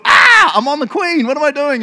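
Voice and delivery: loud high yelp of alarm; hammed-up panicked realisation; mock panic. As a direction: blurt it out in comic horror. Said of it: "and then thought".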